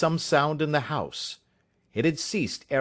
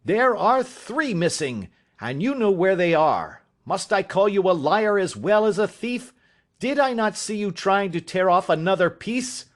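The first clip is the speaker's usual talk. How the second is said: much higher, loud furious charge; furious accusation; 6 semitones higher, 3 dB louder than usual. Acted. Father calling his son a liar and thief, furious. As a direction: press the accusation harder and harder.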